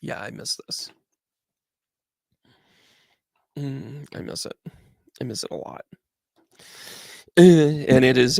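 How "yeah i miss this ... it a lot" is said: much softer, nearly whispered longing; wistful hush. Real, not acted. Coach admitting to viewers he misses the season, hushed and wistful. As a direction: let the missing slip out quietly. Wistful aside about missing it.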